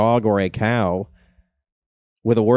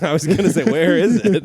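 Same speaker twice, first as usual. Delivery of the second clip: high, loud words through laughter; amused, laughter spilling into the words; laughing while talking; 7 semitones higher, 6 dB louder than usual. Real, not acted. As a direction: chuckle your way through the question. Laughing at own joke.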